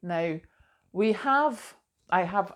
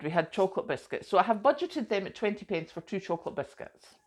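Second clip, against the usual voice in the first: normal talking voice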